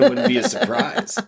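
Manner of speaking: teasing reply, laughing through the words. Real, not acted. Husband laughing as he teases his partner about the surprise. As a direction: laugh it out, pleased with yourself.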